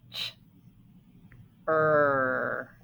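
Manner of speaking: "Ch, er" as two separate sounds, pause between; sounding out. Shown as a demonstration. Teacher splitting the second syllable for pupils, sounding out. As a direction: split "Ch" from a low, held "er". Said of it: "second syllable".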